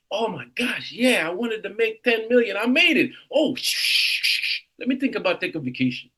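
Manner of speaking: high, bright, thrilled inner voice; excited. Retold or imitated. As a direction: imitate yourself thrilled at reaching the goal. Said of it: "I'm like".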